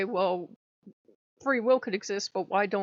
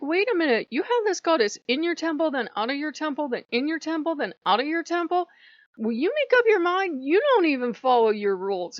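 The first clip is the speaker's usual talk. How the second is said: high, loud bickering complaint; exasperated, loud nagging protest; mock exasperation; 6 semitones higher, 6 dB louder than usual. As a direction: call out a fickle god, pitched up. Acted exasperated challenge to a god.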